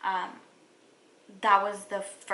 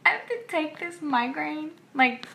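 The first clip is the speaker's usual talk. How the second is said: higher, louder, fed-up groan of disbelief; exasperated dismay; 8 semitones higher, 4 dB louder than usual. Retold or imitated. Told she can't take medicine, higher, louder exasperated dismay. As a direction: sound stunned that you must just endure it.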